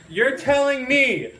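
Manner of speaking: loud, high, incredulous rant; mock outraged shout. Comic to the audience about tattoo doubters, loud incredulous yell. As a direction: blow up in disbelief, mock furious.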